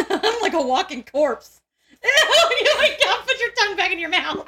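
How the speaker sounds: high, giggling words breaking into laughter; can't get it out for laughing; laughing through words. Real, not acted. Host cracking herself up over a crude joke, laughing.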